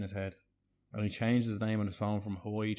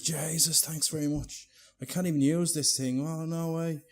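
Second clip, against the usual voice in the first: high-pitched delighted disbelief; gushing, higher-pitched thanks; acted amazement; 7 semitones higher than usual. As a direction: imitate someone overjoyed by a present. Acted Chris amazed by new phone.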